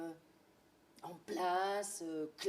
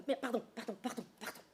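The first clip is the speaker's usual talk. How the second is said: usual speaking voice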